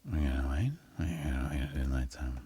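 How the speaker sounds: breathy whispered wait. Pretend. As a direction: whisper it like a secret. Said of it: "whispered repeat".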